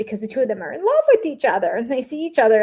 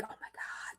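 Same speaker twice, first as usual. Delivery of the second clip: whisper; 25 dB quieter than usual. Retold or imitated. Mocking the cast's reaction.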